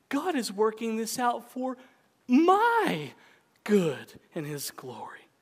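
my shouted high, good and his glory whispered slowly; loud high my, whispered ending; stress on my. Shown as a demonstration. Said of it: "emphasize different words in that sentence".

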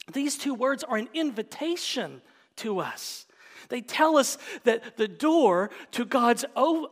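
normal talking voice

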